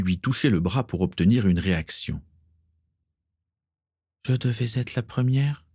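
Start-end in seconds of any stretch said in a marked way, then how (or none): 4.3-5.6 higher, absent-minded murmur of a question; lightly voiced woman lost in thought. A narrator's reading. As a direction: say it as if your mind is elsewhere. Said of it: "dit-elle d'un air absent".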